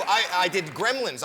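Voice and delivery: ordinary delivery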